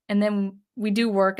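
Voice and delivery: usual speaking voice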